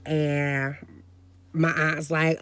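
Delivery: ordinary talk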